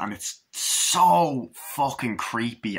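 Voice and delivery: normal talking voice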